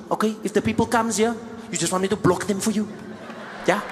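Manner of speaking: put-on lightly accented nervous purser; hint of a South African purser; flight attendant. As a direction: fuss over the celebrity. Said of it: "he's like".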